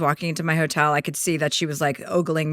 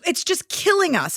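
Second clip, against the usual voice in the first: higher, gushing; mock gushing fan; 10 semitones higher than usual. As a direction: overdo the fan's excitement. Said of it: "she goes".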